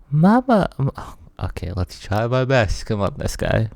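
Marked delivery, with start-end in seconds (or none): 0.1-0.9 put-on high, tearful child's voice; little girl voice. Player voicing the crying girl, high and tearful.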